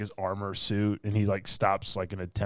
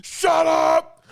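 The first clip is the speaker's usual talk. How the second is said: very high, loud bellow; angry shout; 9 dB louder than usual. Retold or imitated. Hero yelling at the noisy neighbourhood, loud shout. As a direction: blast it at the whole street. Hogan yells at noise.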